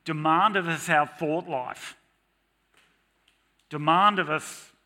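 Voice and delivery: loud, outraged "How dare you, God"; the world shouting its complaint at God; mock indignant shout. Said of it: "Our culture screams at us and says".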